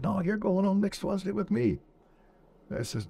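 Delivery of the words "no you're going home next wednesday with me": much higher, put-on insistent voice; insisting gunner. Gunner insists the soldier goes home Wednesday, high insistent voice. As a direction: do the gunner, pitched up and adamant.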